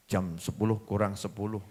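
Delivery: low, soft reply; meek husband. Acted. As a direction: answer her meekly. Husband answers meekly.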